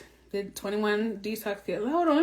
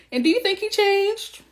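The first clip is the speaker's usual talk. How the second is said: much higher, louder, sarcastic rise; mock incredulous question; 12 semitones higher, 5 dB louder than usual. Real, not acted. Asking viewers about her ex, mock incredulous. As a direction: ask it like it's obvious.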